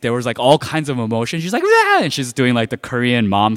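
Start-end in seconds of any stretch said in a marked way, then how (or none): 1.6-2.0 high, loud imitated wail; mom's happy yell. Korean mom finding her lost child, happy yelling. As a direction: yell out in mixed panic and relief. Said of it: "she's yelling at me".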